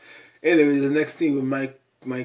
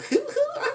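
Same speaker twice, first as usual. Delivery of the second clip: high squeal laugh. Real, not acted. Laughing at Megan.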